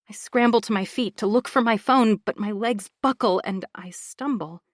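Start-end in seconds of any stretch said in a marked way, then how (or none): none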